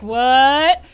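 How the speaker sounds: loud, stunned exclamation; shocked disbelief. Acted. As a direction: blurt it out in mock shock. Reaction to news of death, acted.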